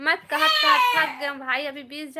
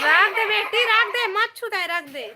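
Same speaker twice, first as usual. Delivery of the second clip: raised, higher voice; urgent parental order; 5 semitones higher, 5 dB louder than usual. Real, not acted. Parent telling daughter not to touch, urgent and loud.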